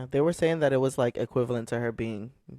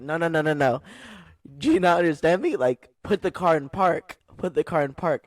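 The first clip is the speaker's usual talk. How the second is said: urgent warning; 4 semitones higher, 4 dB louder than usual. Retold or imitated. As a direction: warn her off before she hits you. Warning driver about to hit her.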